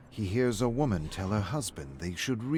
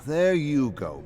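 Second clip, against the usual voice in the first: raised, louder needling jab; mocking friend; 6 semitones higher, 8 dB louder, slower than usual. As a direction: needle him, loud and high. [name] taunts David in bar.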